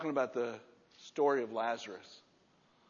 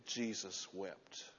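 slow, much softer, hushed; solemn; 5 semitones lower, 9 dB quieter, much slower than usual. Acted. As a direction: let the verse land slowly and softly. Solemn quoting of the verse.

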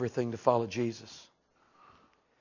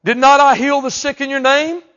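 pleading preacher; 11 semitones higher, 12 dB louder than usual. Acted.

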